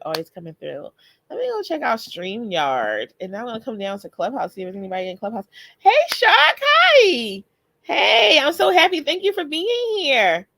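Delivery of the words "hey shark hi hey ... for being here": loud, high-pitched delighted welcome; high, loud burst of happy greeting; excited greeting. Real, not acted. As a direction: squeal hello to a friend who just showed up. Greets a viewer who arrived.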